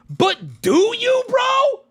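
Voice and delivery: shouting at the screen, bro; exasperated shout. Real, not acted. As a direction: yell it high, fed up and disbelieving. Ranting at a TikToker.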